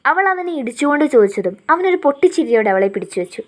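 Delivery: ordinary delivery, nothing marked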